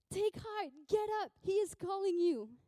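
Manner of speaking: high, loud, excited summons; urgent encouragement. Acted. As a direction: rouse someone with great news. People urgently summon Bartimaeus.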